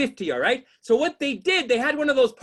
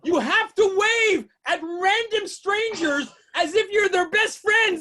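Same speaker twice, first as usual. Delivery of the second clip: ordinary talk, nothing marked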